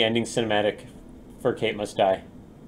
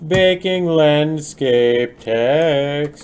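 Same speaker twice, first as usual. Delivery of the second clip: drawn-out announcement; 4 semitones higher, 4 dB louder, much slower than usual. Pretend.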